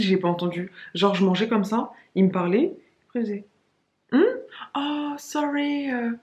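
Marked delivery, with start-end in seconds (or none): 4.1-6.1 high, slow fake-absent reply; feigned distraction. To a colleague at lunch, faking she missed it. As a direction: act like you were miles away. Pretending not to have heard.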